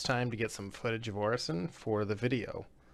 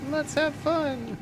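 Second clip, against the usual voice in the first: sing-song; 5 dB louder, much slower than usual. Real, not acted. Cheery start to the session.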